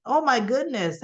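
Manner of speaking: usual speaking voice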